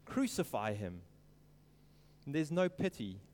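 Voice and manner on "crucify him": low and soft, a muted mock cry; a restrained, quiet crowd chant; hushed retelling of a cry. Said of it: "they cried out".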